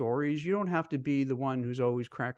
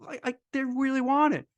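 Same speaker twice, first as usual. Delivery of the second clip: flustered protest; 10 semitones higher than usual. Retold or imitated. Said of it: "I'm like".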